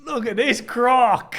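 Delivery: high, loud, slow Aussie wonder; Aussie Steve Irwin accent. Pretend. Friends playing Steve Irwin, loud excited Aussie. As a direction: gush over a croc like a TV wrangler. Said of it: "do your best Aussie accent".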